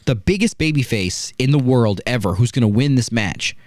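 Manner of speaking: usual speaking voice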